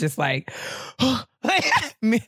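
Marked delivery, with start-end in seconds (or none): 0.5-1.2 soft, high mock gasp; sharp gasp. Unable to settle after the cat, a soft gasp. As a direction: gasp like you're startled.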